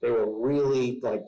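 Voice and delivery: ordinary talk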